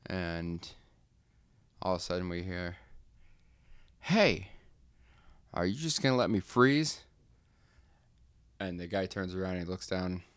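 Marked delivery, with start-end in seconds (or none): 4.0-7.0 loud, high call from the cup; coffee cup yelling. Acted. Cold coffee cup calls out to the worker, loud and high. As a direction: shout up from the desk to get noticed.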